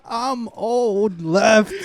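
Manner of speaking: high, laughing whiny wail. Acted. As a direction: whine it high through laughter.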